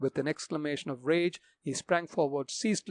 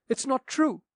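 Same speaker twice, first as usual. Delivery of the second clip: higher protesting cry; 10 semitones higher, 5 dB louder than usual. Acted. Daughter repeating her denial to the captain, higher and desperate.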